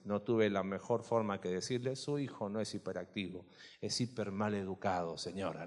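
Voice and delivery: a hint of Marcelo scolding the mother dryly; Marcelo's voice. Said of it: "tiene una voz así". (imitación leve de Marcelo, grave y suave)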